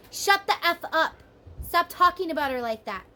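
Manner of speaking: loud, sharp acted telling-off; angry outburst. Partner losing his temper at gossip, loud angry quote. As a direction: snap at them to stop. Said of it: "He's like".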